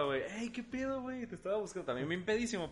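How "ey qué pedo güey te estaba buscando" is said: put-on much higher, cheery drunk greeting; the drunk friend greeting his cousin, pitched way up; drunk buddy. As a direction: greet him like a sloshed pal. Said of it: "sale mi compa".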